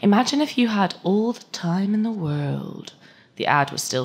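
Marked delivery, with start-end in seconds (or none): 0.0-2.9 silken ad voice. Acted. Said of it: "beaming its silken voice".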